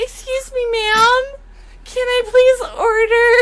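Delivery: lightly acted high prim voice; polite customer. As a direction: play it overly polite to dodge him. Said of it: "I was like".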